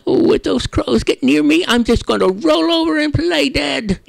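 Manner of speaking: high, loud nervous possum. Acted. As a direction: squeak out the plan like a scared possum. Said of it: "the possum comes out and he says".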